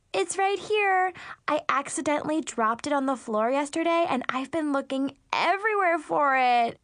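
high, fluttery fake cheer; scrambling excuse, overly bright; flustered mean girl. Mackenzie flustered cover-up.

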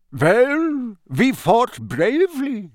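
high, slow, drawn-out gloomy voice; gloomy Bulgarian minister. A narrator's reading. Bulgarian Minister after defeat, gloomy and slow. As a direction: lament it slowly in a Bulgarian accent. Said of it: "said a gloomy voice behind Harry".

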